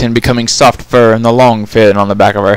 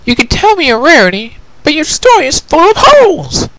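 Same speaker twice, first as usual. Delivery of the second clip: put-on high, firm, insistent young mare; Twilight, arguing; 13 semitones higher than usual. Twilight argues with the prisoner, firm and high. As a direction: argue it, much higher.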